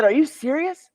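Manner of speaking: retelling his shock to the banker; stunned disbelief. Retold or imitated. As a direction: ask it as if you can't believe your ears. Stunned reaction to bank news.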